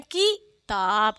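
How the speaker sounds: किताब with a short, quick "ki", then "taab"; clipped "ki", pause, drawn "taab" in किताब. Shown as a demonstration. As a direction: say किताब syllable by syllable, first i kept short. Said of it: "इसको क्या पढ़ा जाएगा".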